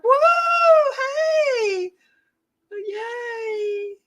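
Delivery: very high shouted whoop of joy; excited squeal. Real, not acted. Painter squealing at a viewer arriving in chat, high and joyful. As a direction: squeal with delight. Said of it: "I get all excited".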